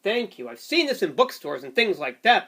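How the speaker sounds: plain reading voice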